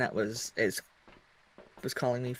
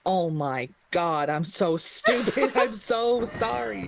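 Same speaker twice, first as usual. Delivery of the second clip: mock-embarrassed, drawn-out and higher; embarrassed apology; 8 semitones higher, 5 dB louder, much slower than usual. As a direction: imitate a flustered man scolding himself. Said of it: "He was like".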